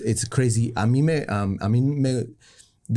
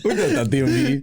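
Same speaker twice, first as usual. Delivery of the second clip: laughing; 14 semitones higher than usual. Real, not acted. Cracking up mid sentence.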